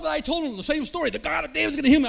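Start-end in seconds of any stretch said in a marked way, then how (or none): 1.1-2.1 proclaiming it loudly, like preaching. Retold or imitated. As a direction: declare it from the pulpit. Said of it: "I started, you know, preaching".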